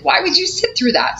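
counselor's question. Retold or imitated. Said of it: "She's like".

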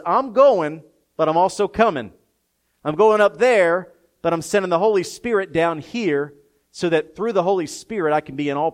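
loud, high, rising scripture proclamation; preaching at full volume; full-force preaching. Acted. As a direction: thunder the promise from the pulpit. Voicing Jesus at full force.

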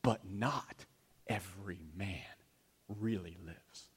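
sermon climax pulled down to a near hush; hushed punchline. Shown as a demonstration. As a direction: drop the voice to land the point. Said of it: "hushed climax of sermon".

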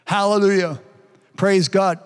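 usual speaking voice

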